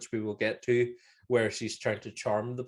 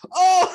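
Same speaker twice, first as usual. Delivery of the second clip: loud, high startled "oh!"; shocked cry; 12 dB louder than usual. Retold or imitated. Imitating a movie victim hit by a spear, startled cry. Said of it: "he's just like".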